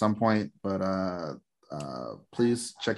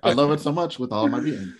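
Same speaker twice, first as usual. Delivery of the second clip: giddy laughing; 8 semitones higher, 5 dB louder than usual. Real, not acted. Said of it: "Now you sound giddy".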